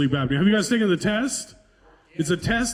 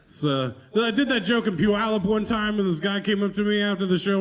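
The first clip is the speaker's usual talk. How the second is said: ordinary delivery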